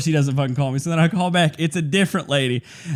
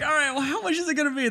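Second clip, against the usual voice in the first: squeaky quick question; 9 semitones higher, 5 dB quieter, faster than usual. Retold or imitated. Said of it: "I'm like".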